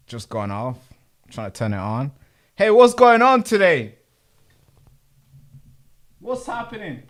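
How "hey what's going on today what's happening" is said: loud, high, baffled shout at the gear; exasperated outcry. Real, not acted. Streamer shouting at the broken screen in exasperation. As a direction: throw it out in bewildered frustration.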